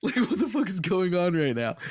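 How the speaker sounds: words broken up by real laughter; laughing disbelief. Real, not acted. Laughing at absurd plot twist.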